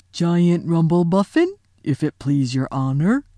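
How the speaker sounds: a big humble giant answering a lion; simple giant answers the lion respectfully; giant's deferential voice. Acted.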